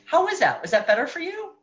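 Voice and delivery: put-on high, cheerful questions to child; bright check-in. Shown as a demonstration. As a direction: check how it felt.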